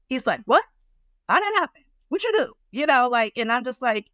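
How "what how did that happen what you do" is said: high, loud mock outrage; imitating furious husband. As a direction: replay the husband's sudden anger, pitched way up. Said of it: "He is furious".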